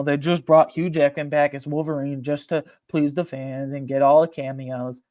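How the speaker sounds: normal talking voice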